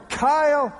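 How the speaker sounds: put-on loud, high-pitched call for a child; yelling. Father calling his lost toddler across a store, yelling. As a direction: yell out for your missing kid. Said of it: "we're, we're yelling".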